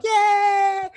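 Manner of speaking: very high, loud squealed cheer; mock crowd cheer. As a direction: cheer like a whole excited crowd. Imitating the crowd cheering the win.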